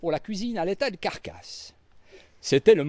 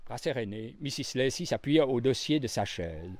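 usual speaking voice

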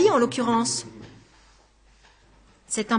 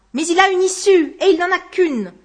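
higher and louder, hammering it home; insistent; 8 semitones higher, 8 dB louder than usual. Acted. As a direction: press it triumphantly. Textor presses his point. (Textor insiste, plus haut et plus fort)

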